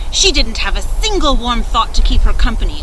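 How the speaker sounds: plain reading voice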